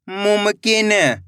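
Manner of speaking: slow, drawn-out blending of the word; slow clear reading. Shown as a demonstration. Teacher to pupils, blending the word slowly. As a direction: stretch each syllable for the pupils. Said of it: "मैं किस तरीके से एक एक अक्षर का उच्चारण".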